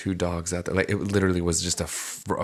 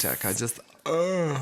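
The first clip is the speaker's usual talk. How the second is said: mock dramatic groan; 4 semitones higher, 4 dB quieter than usual. Teasing her co-host's time crunch with a mock exasperated groan. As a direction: overact being swamped and fed up. Mocking a busy person's complaint.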